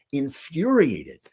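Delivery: infuriated drawn out slowly, each syllable weighted; slow, weighty. Real, not acted. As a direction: let infuriated sink in slowly. Repeats word for emphasis.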